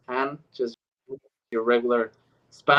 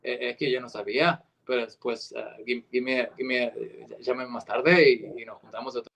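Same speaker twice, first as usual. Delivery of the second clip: lower-pitched Spanish from Spain; Castilian Spanish accent; 3 semitones lower than usual. Shown as a demonstration. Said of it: "That's Spanish from Spain".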